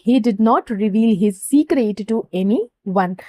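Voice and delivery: each English word said very slowly and clearly; slow example sentence. Shown as a demonstration. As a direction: read the example slowly for students to copy. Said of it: "तो हम कैसे बोलेंगे". (धीरे-धीरे साफ़ बोला गया उदाहरण वाक्य)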